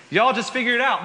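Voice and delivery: put-on overdone Southern accent, high and loud; hammed-up southern accent. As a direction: lay the Southern accent on thick through a laugh. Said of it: "God just had a southern accent in that".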